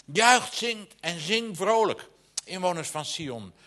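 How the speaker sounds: high, ringing preacher's read, swelling into the call to sing; preaching scripture. Acted. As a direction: proclaim it from the pulpit, lifting to a slow joyful shout. Reads scripture with rising fervour.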